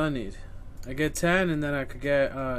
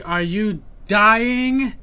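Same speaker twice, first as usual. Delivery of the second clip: slow sarcastic question; 3 semitones higher, 8 dB louder, much slower than usual. Acted. Sarcastic build-up to outburst.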